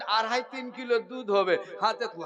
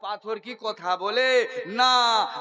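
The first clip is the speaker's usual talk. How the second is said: normal talking voice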